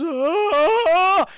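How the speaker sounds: long, high, loud scream; hammed-up howl of dismay; mock horrified scream. Max reads the ornament, cries out.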